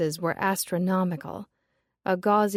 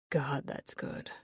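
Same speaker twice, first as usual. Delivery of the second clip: breathy whisper after a long drag; 5 semitones lower, 10 dB quieter, slower than usual. Acted. Shop owner Robin savoring her cigarette, whispering. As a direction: sigh the words out in a whisper.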